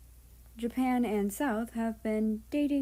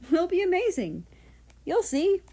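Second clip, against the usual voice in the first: much higher, loud, upbeat promise; excited reassurance; 10 semitones higher, 7 dB louder than usual. Acted. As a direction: lift him up with bright confidence. Husband reassures nervous partner.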